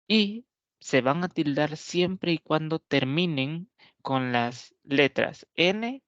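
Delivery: usual speaking voice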